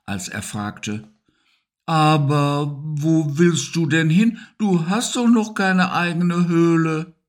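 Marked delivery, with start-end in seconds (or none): 1.8-7.1 shaken. Acted. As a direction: ask as if the ground gave way. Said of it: "klang er ziemlich erschüttert". (hoch, langsam, erschüttert)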